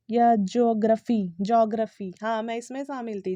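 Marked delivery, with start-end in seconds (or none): 0.4-2.1 "ज्योग्राफी" as JO-gruh-fee; "ज्योग्राफी" without the "ee" sound; common mispronunciation. Shown as a demonstration. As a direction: merge the start of "ज्योग्राफी" into one "jo". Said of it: "बहुत लोग ना इसे कहते हैं".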